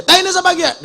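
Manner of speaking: very high, loud mock shout; shrieked-up Ngapak question; indignant Ngapak yell. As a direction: imitate a Ngapak villager yelling out. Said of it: "Orang ngapak teriak".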